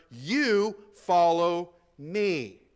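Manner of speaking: very slow "You follow me" with weight on "You"; stress on you. Shown as a demonstration. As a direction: drag it out, leaning on "You". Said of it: "He was emphasizing it".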